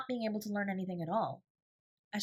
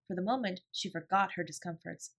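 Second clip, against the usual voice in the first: usual speaking voice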